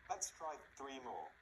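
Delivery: ordinary talk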